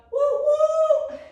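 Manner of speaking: high, loud hooting woo; train whistle. Pretend. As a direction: hoot like a steam train.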